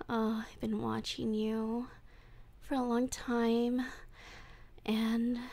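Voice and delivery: creepy lovesick voice, drifting into breathy whispers; obsessive captor. Acted. Captor confesses love to tied-up Wolfie, creepy and hushed. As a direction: confess your obsession to your captive, unhinged.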